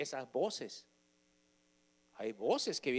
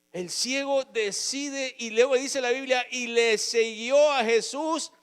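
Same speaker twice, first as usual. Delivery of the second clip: loud, high fervent preaching, slowing on key phrases; preaching at full force; 10 semitones higher, 10 dB louder than usual. Acted. Preacher telling the congregation how Jesus healed the blind man, fervent. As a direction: proclaim the healing to the church.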